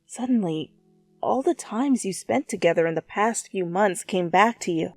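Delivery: ordinary talk, nothing marked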